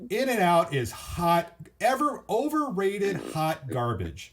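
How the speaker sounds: high, slow, drawn-out scorn; mock disdain. Real, not acted. Host trashes In-N-Out to agreeing guest, mock disdain. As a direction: trash the burger chain with relish.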